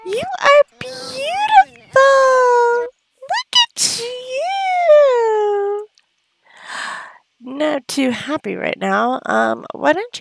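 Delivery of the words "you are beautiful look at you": very high, slow adoring coo; gushing baby talk. Acted. Gushing over her Sim's new look in a high coo. Admiring a grown-up Sim.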